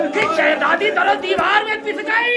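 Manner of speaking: high, wailing cry at full force; lamenting preaching. Acted. Reciter wails the tragedy. (ऊँची आवाज़ में मातमी पुकार)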